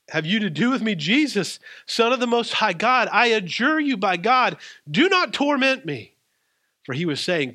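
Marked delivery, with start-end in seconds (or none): 0.1-6.2 high, loud pleading of a possessed man; desperate cry. A narrator's reading. Possessed man cries out to Jesus.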